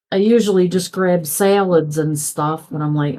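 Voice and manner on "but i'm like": ordinary talk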